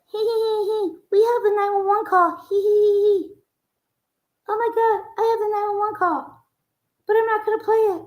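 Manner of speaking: high, giggly taunting mimicry; high mock-giggle impression of a gloater; mocking gloating voice. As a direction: sneer it out like a giggling gossip. Mocking someone gloating about call.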